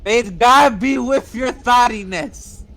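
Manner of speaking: slow, high, hammy benediction; mock priest blessing. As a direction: bless them like a pompous priest.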